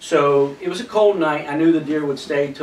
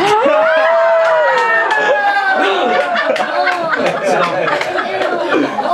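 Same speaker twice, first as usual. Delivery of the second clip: helpless high laughter, much louder; long loud high laughter; 6 dB louder than usual. Real, not acted. Laughing at own story.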